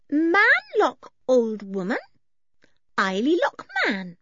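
put-on very high, slow childlike voice; little one reporting in broken phrases; childlike Eily. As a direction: speak simply in a high, drawn-out singsong.

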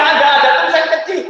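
ordinary talk, nothing marked

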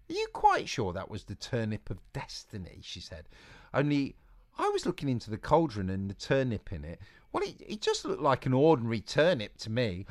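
put-on a plucky girl talking herself out of fear; Eliza. Eliza voiced.

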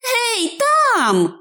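high-pitched, tremulous hail; trembling call. A narrator's reading. Hobbit hails a stranger among the rocks, trembling high call. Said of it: "погукав він тремтливим голосом".

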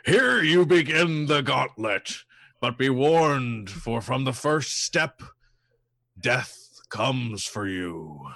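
slow, booming dungeon voice. Acted. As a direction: boom it out like a doom-laden oracle. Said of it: "the voice booms out again".

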